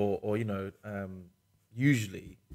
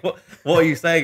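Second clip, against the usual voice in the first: higher, louder baffled outburst; mock bewildered; 7 semitones higher, 11 dB louder than usual. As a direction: act like you can't follow a word. Retelling confused reaction to speaker.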